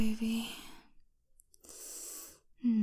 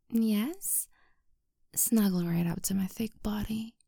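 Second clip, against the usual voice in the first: whisper. Acted. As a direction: whisper it gently, like comforting a partner. Whispered snuggling.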